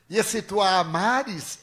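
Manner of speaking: spirit's warning. Retold or imitated. Spirit states its condition.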